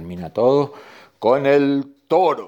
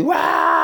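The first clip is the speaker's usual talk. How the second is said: goat's pained yell; 7 semitones lower, 4 dB louder than usual. Acted. Goat bitten by the ant, long acted yell. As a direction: let out a drawn-out howl of pain. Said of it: "Dijo la chiva que no le gustaba".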